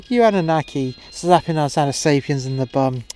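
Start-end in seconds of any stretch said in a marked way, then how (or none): none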